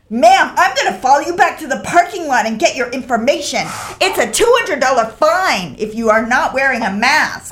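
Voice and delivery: mock the fine-threatening hiker; officious stranger. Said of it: "She was like".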